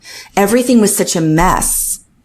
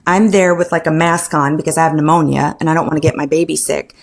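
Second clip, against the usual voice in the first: ordinary delivery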